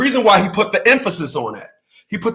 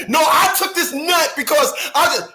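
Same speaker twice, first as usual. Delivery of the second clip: mock protest; 7 semitones higher than usual. Mocked man's excuse.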